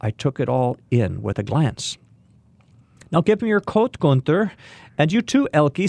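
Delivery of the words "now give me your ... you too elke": put-on old woman's voice. A narrator's reading. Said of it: "said the old woman".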